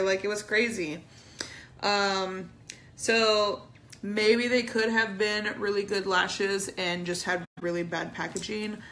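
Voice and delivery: usual speaking voice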